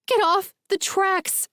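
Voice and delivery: high, thin, slow panicked cry; panicked scream. A narrator's reading. Naomi screaming at Jake to get off the tracks, high and thin. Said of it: "I scream, my voice high and thin".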